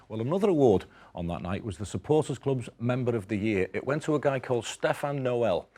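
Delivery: presenter read. Acted. Presenter reads award package.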